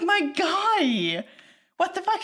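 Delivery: outraged disbelief at his pushiness; exasperated disbelief. Real, not acted. Outraged at pushy character.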